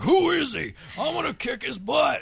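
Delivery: hammed-up jealous boyfriend yelling; jealous guy shouting threats, hammed up; macho guy. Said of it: "most guys are like".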